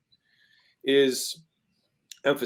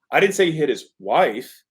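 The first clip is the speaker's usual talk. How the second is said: "wife" held back, then punched; slow sentence landing on "wife"; stress on WIFE; 3 semitones higher, 4 dB louder than usual. Shown as a demonstration. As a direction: save the weight for the word "wife". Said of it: "The emphasis is".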